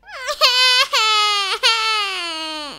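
long bleating cry, falling slowly; drawn-out wavering "waaah"; baby dragon's wail. A narrator's reading. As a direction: wail like an upset baby creature. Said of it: "Cried the baby dragon".

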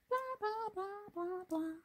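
high, far-off gabble of chatter; gabbling blah blah. Retold or imitated. Narrator mimics Harry's endless chatter, high gabble. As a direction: rattle off blah blah like nonstop talk.

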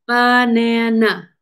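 "Banana" drawn out slowly in three level beats; syllable by syllable. Shown as a demonstration. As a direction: count out "Banana" slowly, one beat per syllable. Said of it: "How many syllables?".

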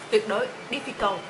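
ordinary delivery

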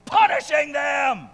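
slow build into a high shout on "punishing them"; crying out. Acted. Habakkuk demanding justice from God, crying out. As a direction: raise it to a drawn-out, outraged yell. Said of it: "Habakkuk is crying out".

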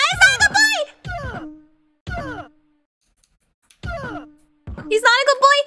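panicked squeal. Real, not acted. Player fleeing a robot that is not friendly, squealing.

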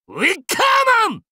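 high, loud shout of the spell name. Acted. Hero shouting the spell name as the fire giant rises, loud. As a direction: yell the spell name to unleash it.

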